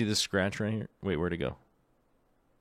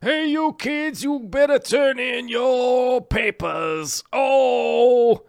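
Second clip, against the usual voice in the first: much higher, slow cartoon scolding; angry teacher voice; 7 dB louder, much slower than usual. Streamer voicing his whiteboard doodle of a teacher, high and slow scolding. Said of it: "he's the angry teacher guy".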